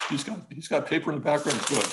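ordinary talk

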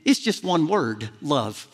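a hush that lands on love; gentle voice of God. Retold or imitated. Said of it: "I heard him just so gently say".

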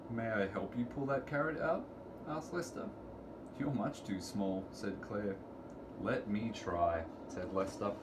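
ordinary delivery, nothing marked

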